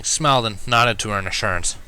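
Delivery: usual speaking voice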